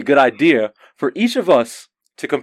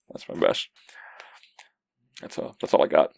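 whispered, much lower and softer; embarrassed whisper; 10 semitones lower, 9 dB quieter than usual. Real, not acted. Owning up to a weak impression, a whispered admission. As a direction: sheepishly whisper it off.

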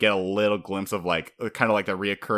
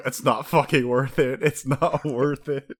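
grumbling, laughing through it; a hint of a grumbling Zoro; mock grumbling; 6 semitones higher, 3 dB louder, slower than usual. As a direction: sulk it out with a laugh. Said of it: "looking at the name going".

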